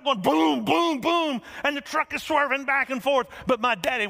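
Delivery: high, loud breathless telling; excited storytelling. Acted. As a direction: tell the getaway at full throttle. Climax of the escape story.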